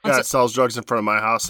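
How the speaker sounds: ordinary delivery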